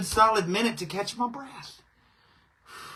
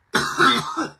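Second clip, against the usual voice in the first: hacking cough; 6 semitones higher, 5 dB louder than usual. Real, not acted. Coughing again.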